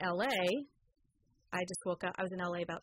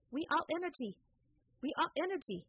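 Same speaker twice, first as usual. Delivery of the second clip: broken English quote; 8 semitones higher than usual. Retold or imitated. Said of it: "this lady, pretty much no English".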